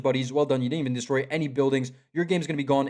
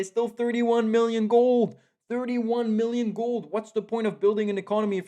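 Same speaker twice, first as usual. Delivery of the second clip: exasperated; 7 semitones higher, 3 dB louder than usual. Real, not acted. Caster exasperated at unused gold.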